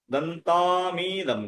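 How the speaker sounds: দন্তামিদম as one very slow, stretched word; slow, unbroken model of দন্তামিদম; joined pronunciation. Shown as a demonstration. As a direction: run দন্তম into ইদম, holding the long vowels. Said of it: "উচ্চারণটা কি করবো আমরা?".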